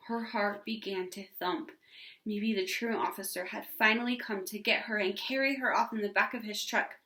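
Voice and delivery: plain reading voice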